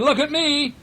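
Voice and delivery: high-pitched excited call. A narrator's reading. Toy clown calls to friends from atop the engine, calling out high. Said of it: "he called out".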